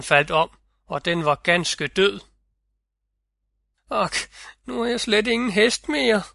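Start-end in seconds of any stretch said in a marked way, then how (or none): 3.9-6.2 high, despairing wail over the dead horse; mournful lament. A narrator's reading. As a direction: lament the lost horse, voice lifting in grief. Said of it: "gav sig til at græde". (højt, klagende og fortvivlet)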